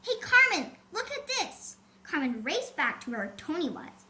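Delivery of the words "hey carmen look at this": excited cry. A narrator's reading. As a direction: call out excitedly to a friend. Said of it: "Toni cried".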